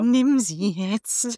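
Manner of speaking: very slow, soft, seductive murmur; tempting inner voice. Retold or imitated. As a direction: coax him slowly under the breath. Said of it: "Cold Mirror im Zwiegespräch mit ihrem Gehirn".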